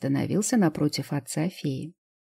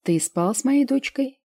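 menacing question; 7 semitones higher than usual. A narrator's reading. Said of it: "Грозный вопрос".

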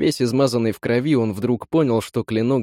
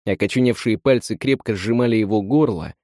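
ordinary delivery, nothing marked

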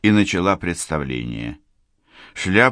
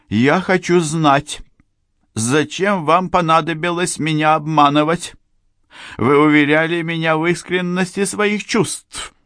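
demanding woman; 11 semitones higher, 3 dB louder than usual. Acted. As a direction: insist on the truth.

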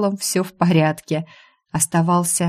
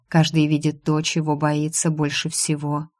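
usual speaking voice

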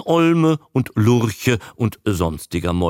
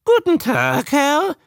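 high squawked greeting, rising; high-pitched creature mimic; newt repeating; 4 dB louder than usual. A narrator's reading. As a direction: parrot it back in newt voice. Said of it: "wiederholte der Molch".

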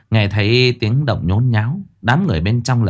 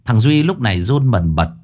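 plain reading voice

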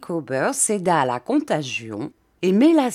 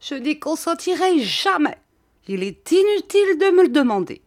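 high, distraught outburst building louder; increasingly agitated; 10 semitones higher, 4 dB louder than usual. A narrator's reading. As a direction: get more and more worked up, voice climbing. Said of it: "de plus en plus émue".

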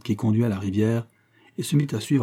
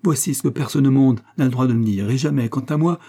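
raised, louder indignant rebuke, mildly severe; stern; 4 semitones higher, 5 dB louder than usual. A narrator's reading. Agnès rebuking Frédéric's accusation, mildly stern. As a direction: forbid it with a touch of severity. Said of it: "dit d'un air sévère".

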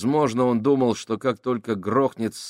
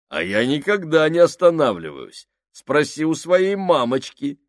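выше и громче, с вызовом; дразни в полный голос; громкая насмешка; 7 semitones higher, 7 dB louder than usual. Acted. Сакс дразнит Фабрини. (Сакс дразнит Фабрини, громко и дерзко)

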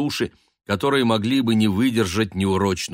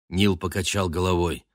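usual speaking voice

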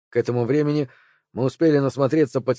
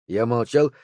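usual speaking voice

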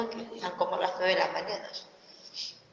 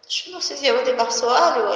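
anxious outburst; 3 semitones higher, 8 dB louder than usual. Retold or imitated. Worried mother, daughter came late.

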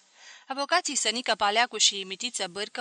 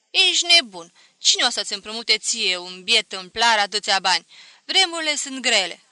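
scolding disbelief; 9 dB louder than usual. A narrator's reading. Relatives scolding George over the loan, loud and scoffing. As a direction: scoff at the foolish plan.

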